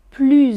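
"plus" said "ply", ready to link with a Z; plus before a vowel. Shown as a demonstration. Teacher shows the third way to say it. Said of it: "Troisième".

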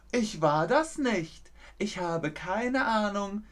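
high, drawn-out innocent voice; sweetly pitched fake denial; feigned innocence. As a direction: play the harmless one who knows nothing. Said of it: "die ganz harmlos tun, obwohl sie frech sind".

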